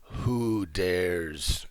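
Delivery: slow, low undead lich voice; a deep, dragged-out tomb voice; ancient rasping undead king. Acted. Undead lich speaks to the party.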